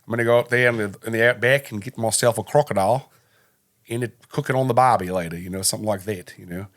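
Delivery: loud put-on Australian accent; hammed-up Australian outback talk. Shown as a demonstration. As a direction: demonstrate broad Australian, loudly. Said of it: "Australian is kind of like".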